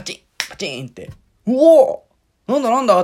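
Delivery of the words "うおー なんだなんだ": high, loud, stretched-out wow; surprised cheer. Retold or imitated. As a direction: act out being startled and thrilled. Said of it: "ってなるじゃないですか。". (驚いて高く大きく叫ぶ)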